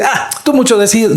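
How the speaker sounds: high-pitched mock brush-off; put-on scoffing objection; dismissive. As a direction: mimic someone taking it lightly. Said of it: "tomarlo a la ligera y decir".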